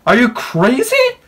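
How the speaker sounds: high, loud incredulous yelling; outraged protest. Real, not acted. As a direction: yell in outrage, pitch shooting up. Outraged reaction to game villain.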